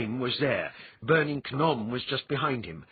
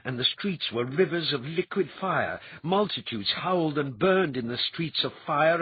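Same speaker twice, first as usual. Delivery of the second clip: usual speaking voice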